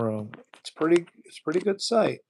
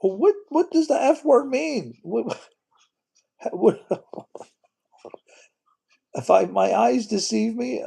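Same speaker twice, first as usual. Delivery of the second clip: baffled; 8 semitones higher, 3 dB louder than usual. Real, not acted. Baffled by the F-bomb message.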